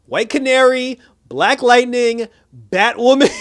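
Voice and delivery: loud, rising, drawn out; incredulous listing. Real, not acted.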